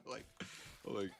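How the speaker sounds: normal talking voice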